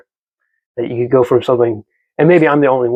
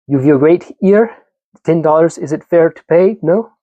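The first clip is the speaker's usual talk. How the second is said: a hammed-up Russian accent, pitched higher and slow; bad Russian accent; 5 semitones higher than usual. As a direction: play the melodramatic Russian woman in a cheesy Russian accent. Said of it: "Bad Russian, I know".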